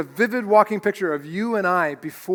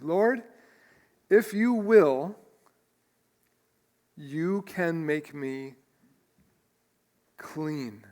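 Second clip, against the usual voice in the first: weighted words; much slower than usual. Acted. Preacher reading the leper's plea to Jesus, every word weighted. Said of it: "every word in this phrase is weighted".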